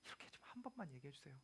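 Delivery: quick, soft unvoiced plea; hushed pleading prayer; whispered plea. Real, not acted.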